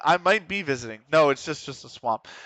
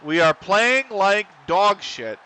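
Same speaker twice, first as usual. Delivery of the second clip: louder, higher and slow, disgusted with the team; fed-up outburst; 6 semitones higher, 6 dB louder, slower than usual. Real, not acted. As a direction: spit it out at your useless players. Team conceding, manager fuming.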